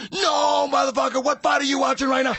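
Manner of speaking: high, loud, disbelieving shout; exasperated shout. Retold or imitated. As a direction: yell it at a friend who doesn't get it. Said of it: "friend yelling on phone".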